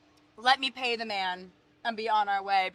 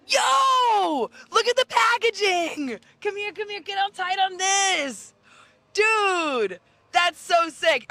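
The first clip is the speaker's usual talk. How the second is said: excited shout; 8 semitones higher, much slower than usual. Real, not acted. Excited by ice cream packaging.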